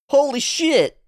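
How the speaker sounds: ordinary talk, nothing marked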